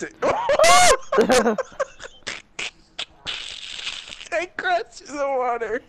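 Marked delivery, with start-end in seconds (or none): 0.2-2.7 real squealing laugh, loud and high; high rising laugh. Real, not acted. Laughing at crash.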